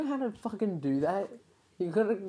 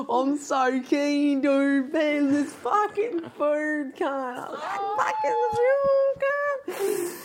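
loud, slow, delighted yelling; ecstatic shouting; 5 semitones higher, 5 dB louder, slower than usual. Real, not acted. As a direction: shout it out in pure joy. Ecstatic over food, laughing.

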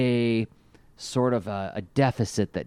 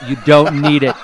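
loud mock refusal through laughter; higher and louder, cracking up on the words; laughing; 4 semitones higher, 11 dB louder than usual. Podcaster playing robot taking Cliffjumper's gun, laughing.

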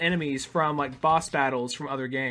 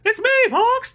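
very high squeaky Slippy voice; high girlish voice; 5 dB louder than usual. Retold or imitated. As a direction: chirp it like a tiny high-voiced kid. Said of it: "he went from being like".

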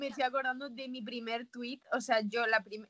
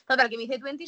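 ordinary talk, nothing marked

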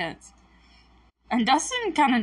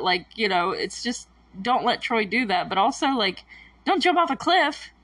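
ordinary talk

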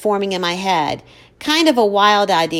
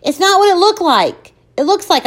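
high, loud flustered blurt; 10 semitones higher, 6 dB louder than usual. Acted. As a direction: blurt it out in a panic. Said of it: "I blurt".

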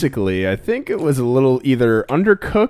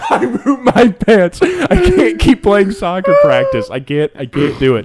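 laughing confession; 10 semitones higher, 5 dB louder, slower than usual. Retold or imitated. Confessing to dad in the car.

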